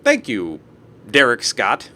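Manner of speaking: usual speaking voice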